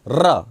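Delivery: "र" with the tongue vibrating first; trilled र. Shown as a demonstration. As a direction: roll the "र" on the tongue tip. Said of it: "जीवा को पहले कंपन करना". ("र" जीभ में कंपन के साथ)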